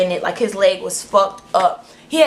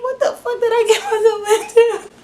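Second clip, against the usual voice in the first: very high, whining half-laughing cry; hammed-up squeal of regret; panicked mock wail; 12 semitones higher than usual. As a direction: wail in comic despair.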